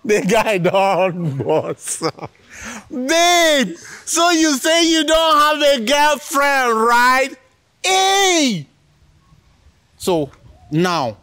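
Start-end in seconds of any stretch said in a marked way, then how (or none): 2.9-8.6 high teasing copy of her; mimicking girlfriend. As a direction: parrot her question in a high girly voice. Host laughingly repeats girlfriend's question.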